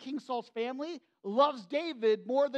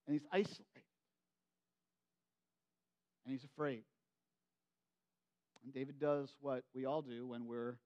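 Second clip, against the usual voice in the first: hushed sermon; 10 semitones lower, 9 dB quieter than usual. Acted. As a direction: draw the congregation in with a near-hushed voice. Sermon drops to intimate hush.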